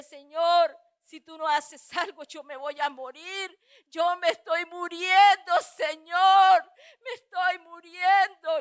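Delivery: full-force, slow wail of a plea; anguished crying out. Retold or imitated. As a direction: cry out to God as if dying.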